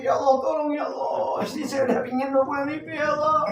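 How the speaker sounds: soft, pleading mock weeping; acted tearful prayer. Husband praying at the mosque for his wife, imitated tearful plea. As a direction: plead softly as if crying in prayer. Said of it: "Suaminya nangis juga pergi ke masjid".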